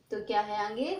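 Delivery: usual speaking voice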